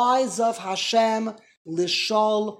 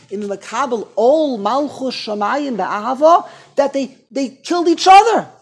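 much higher, loud fiery sermon; preaching at full force; 5 semitones higher, 10 dB louder than usual. Acted. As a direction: drive it home from the pulpit. Preaching peak.

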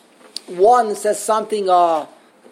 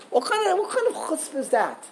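outraged disbelief; 7 semitones higher, 4 dB quieter than usual. Real, not acted.

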